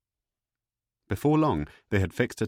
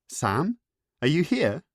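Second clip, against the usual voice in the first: eager greeting; 9 semitones higher, 5 dB louder, slower than usual. Acted. Josephine answering Gaddis excitedly.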